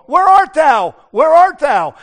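loud, high calling voice. Retold or imitated. God calling out loud for Adam and Eve in the garden. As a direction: call out across the garden, searching. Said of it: "God that came looking for them".

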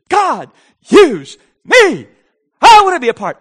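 high, slow shouts to God; brash, bold plea. Acted. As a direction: cry out each word with gall. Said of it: "Have a lot of gall".

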